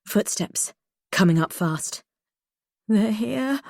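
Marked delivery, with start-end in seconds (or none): 2.9-3.6 fearful moan. A narrator's reading. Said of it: "I moan".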